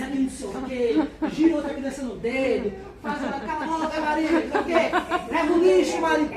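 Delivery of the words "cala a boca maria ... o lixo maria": put-on loud, higher, half-laughing scolding of a kid; mock mother yelling. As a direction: snap at Maria like a harried mom juggling chores. Busy mother yelling at child.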